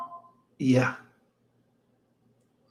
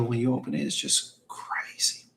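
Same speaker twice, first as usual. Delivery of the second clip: hushed whisper, like revealing a secret; whispered awe; 6 semitones lower, 8 dB quieter than usual. Real, not acted. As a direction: breathe it out in wonder. Marvelling at perfume box presentation.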